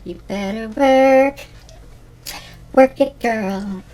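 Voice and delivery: slow, loud, sassy cheer; runway hype. Shown as a demonstration. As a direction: cheer on a model strutting the runway. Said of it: "the next word we're gonna use is called work".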